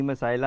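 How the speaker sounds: مسائلہ said as "ma-sa-i-la"; "masaila", with an extra glide before the last syllable; mispronounced word. Shown as a demonstration. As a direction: echo the wrong reading مسائلہ with a long "saa-i" in the middle. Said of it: "اس کا صحیح تلفظ نہیں ہے".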